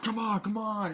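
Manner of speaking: high, impatient mock yell; re-enacted urgent shout; acted urging. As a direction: egg them on like a worked-up soldier. Acts out soldiers urging.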